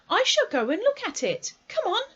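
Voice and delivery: put-on high, loud, decisive boy; boy's voice. A narrator's reading. Christopher Robin setting off to look, high boyish voice.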